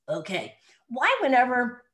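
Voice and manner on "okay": low, dull grunted okay; a dim caveman agreeing; lightly voiced caveman okay; caveman man voice. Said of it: "I use the man's voice".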